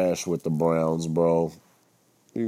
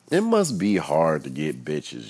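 ordinary talk, nothing marked

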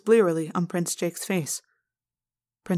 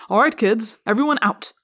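louder, brisk command; snapped order; 3 semitones higher, 6 dB louder than usual. A narrator's reading. Assistant principal clearing pupils out, snapping. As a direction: snap at the kids to clear out. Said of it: "Chapman snapped".

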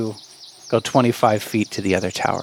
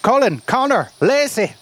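hammed-up Irish lilt, pitched up; horrible fake Irish accent; 9 semitones higher than usual. Game master voicing leprechauns who transform buffoons, in a bad Irish accent. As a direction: put on a bad Irish brogue. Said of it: "God, I have a horrible accent".

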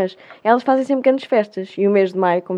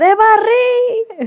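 much higher, very slow mock yell of the name; retold angry yell; 12 semitones higher, 5 dB louder, much slower than usual. Club president shouting at the host to correct the name, drawn-out mock yell. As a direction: bellow the correction like a furious club boss. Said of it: "aos berros".

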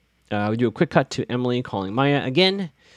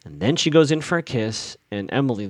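ordinary talk

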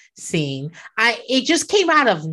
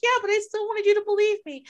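put-on whiny kid; 11 semitones higher, faster than usual. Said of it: "they were like".

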